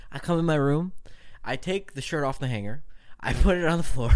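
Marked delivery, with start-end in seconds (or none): none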